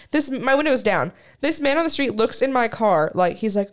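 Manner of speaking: normal talking voice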